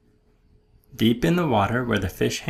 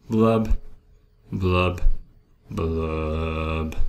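each blub sinks lower, last one long and deep; glum blubbing; 6 semitones lower, much slower than usual. Acted. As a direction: bubble it out, sinking into gloom. Fish's sinking refrain.

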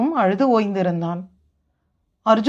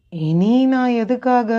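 slow, drawn-out hopelessness; despairing. Acted. Grieving brother asks what he should live for, slow and despairing. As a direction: draw it out as if given up.

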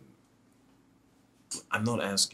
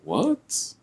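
surprised; 8 dB louder than usual. Acted. Sudden surprised reaction.

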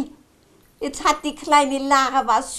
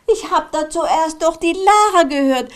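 ordinary delivery

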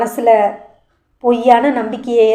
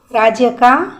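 usual speaking voice

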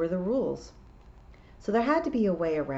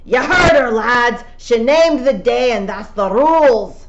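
rallying shout; 7 semitones higher, 13 dB louder than usual. A narrator's reading. Rob rallying the lads.

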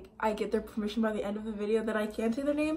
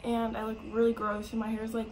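ordinary delivery

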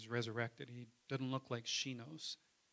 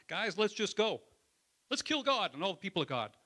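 higher, louder, fast rallying cry; zealous Saul rallying; 6 semitones higher, 6 dB louder than usual. Retold or imitated. Saul urges his recruits to go kill, rallying shout. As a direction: egg the guys on to go. Paul rallying men to persecute.

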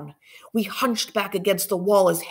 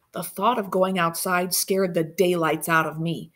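usual speaking voice

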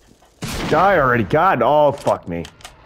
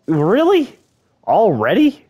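exasperated disbelief; 9 semitones higher, 4 dB louder than usual. Real, not acted. Killed again right away.

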